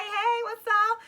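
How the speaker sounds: high, loud, drawn-out hey; flirty greeting. Retold or imitated. Flirt greeting two men at the resort, high and flirty. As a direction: call out a bubbly, flirtatious hello. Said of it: "a flirt. So I'm just like".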